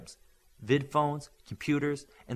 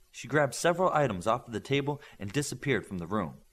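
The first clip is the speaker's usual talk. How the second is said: ordinary delivery, nothing marked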